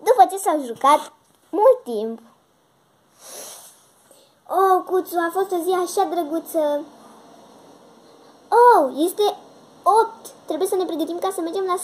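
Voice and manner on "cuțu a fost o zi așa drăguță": usual speaking voice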